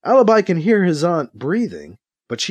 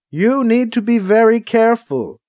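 warning; 8 semitones higher, 4 dB louder than usual. A narrator's reading. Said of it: "she warns him".

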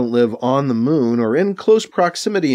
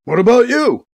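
higher, louder, livelier question; brightening a bit; 9 semitones higher, 6 dB louder than usual. A narrator's reading. Edwards turns the question on B C, brightening a bit. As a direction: perk up a little as you ask. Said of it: "brightening a bit".

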